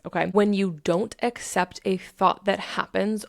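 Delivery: ordinary talk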